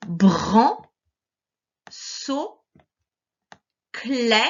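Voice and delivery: BRAN, SAUT, CLÉ each stretched and lifted at the end; slow, rising syllable reading of BRAN, SAUT, CLÉ; read-along syllables. Shown as a demonstration. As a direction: model one syllable at a time, BRAN, SAUT, CLÉ, with long pauses. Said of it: "lire en même temps que moi".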